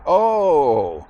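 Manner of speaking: loud, high falling "oh"; big mock penny-drop; dawning realization. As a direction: imitate kids suddenly getting it. Retold boys finally understanding.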